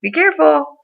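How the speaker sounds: lightly mocked high boss voice; boss impression, breezy. Boss to a panicking employee, high mock voice. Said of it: "He goes".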